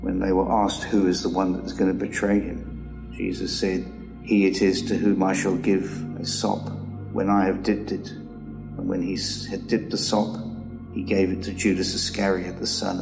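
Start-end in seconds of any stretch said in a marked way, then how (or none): none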